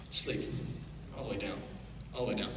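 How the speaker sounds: low, soft all the way down; hushed hypnotist induction; soft trance cue. Acted. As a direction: sink them down quietly.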